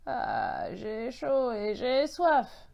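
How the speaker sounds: slow, drawn-out hoarse echo. A narrator's reading. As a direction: repeat it as a parched, croaky voice. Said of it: "a répété une voix enrouée".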